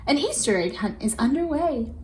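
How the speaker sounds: ordinary delivery, nothing marked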